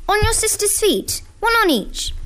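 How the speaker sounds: high, acted parent's reply; Mum calling back. Acted answer called back by Mum.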